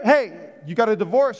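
usual speaking voice

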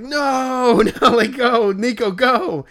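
high loud laughing plea; retelling friends yelling go, half laughing; laughing shout. Retold or imitated. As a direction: laugh while yelling at him to go. Friends urging player to leave.

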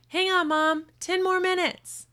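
high loud stalling call; kid calling back. Acted. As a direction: shout back to buy time. Kid answering mom's call.

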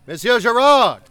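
loud, high hail across the deck; captain hailing Monsieur Gerard from across the room, loud; calling out. Acted. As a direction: shout over the crowd to fetch the surgeon's mate. Calling across to the surgeon's mate.

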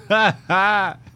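big loud laugh breaking out; loud laugh, high and sudden; loud burst of laughter. Real, not acted. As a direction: crack up hard at the clip. Streamer cracks up at rant.

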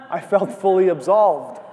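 laughing while saying it. Real, not acted. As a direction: chuckle through the words. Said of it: "How did you feel after that?".

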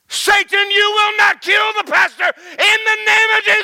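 loud, high commanding rebuke; fierce shouted rebuke of Satan; rebuking shout. Retold or imitated. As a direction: cast Satan out at full voice. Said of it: "she jumped up and said".

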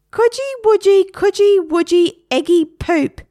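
put-on high, loud parrot baby-talk; a squawky, teasing parrot chant; Harry. Parrot teasing boy he sits on, high squawk. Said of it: "said Harry".

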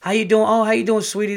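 high, loud gushing greeting; high-pitched mock sugary greeting; over-the-top sweet lady. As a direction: gush it like an overly sweet hygienist. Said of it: "not like Rosie Perez over the top".

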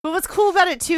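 ordinary talk

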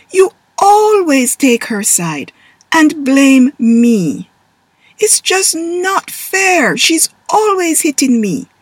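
high, slow, loud complaint; a wronged kid who won't let it go; sulky whine. Acted. Taylor blaming Dad, high and whiny.

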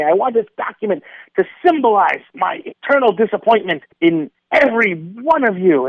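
high, loud, raging outburst at all of humanity; furious rant. Retold or imitated. Venting to a bureaucracy clerk, furious shouting. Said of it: "And I just erupt in fury".

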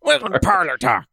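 loud, high pirate greeting. Pretend. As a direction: bellow it like a pirate captain. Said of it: "in your pirate voice".

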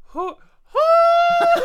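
high shrieking huh huh, loud; Zant's yelp. Retold or imitated. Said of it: "he makes one small".

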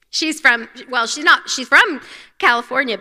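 ordinary talk, nothing marked

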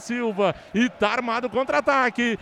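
fast play-by-play. Real, not acted. Live radio play-by-play.

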